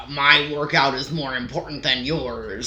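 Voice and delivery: lightly mocked self-importance; imitating self-important gym-goer. Impatient gym-goer to another lifter, mock self-important voice. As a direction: put on a hint of an entitled lifter. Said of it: "Versus, like".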